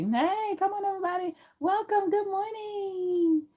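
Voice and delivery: very high sing-song cheery greeting; high-pitched forced cheer; fake happy person. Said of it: "you have to be this happy person".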